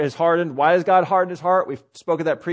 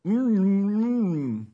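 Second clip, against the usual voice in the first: closed-mouth humming mumble, softer; unintelligible mumble; 3 semitones higher, 4 dB quieter, much slower than usual. Acted. Fisherman answering how he catches fish, unintelligible mumble. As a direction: mumble wordlessly through a shut, full mouth.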